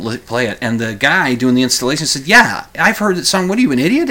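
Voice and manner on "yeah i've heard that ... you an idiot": higher, scornful tone; lightly voiced scornful installer; mocking installer. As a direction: scoff at him. Installer mocks Joe.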